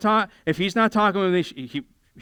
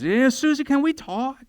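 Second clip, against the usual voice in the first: imitating a woman; 8 semitones higher than usual. Women voiced making up.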